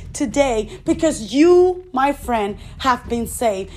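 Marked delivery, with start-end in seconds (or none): none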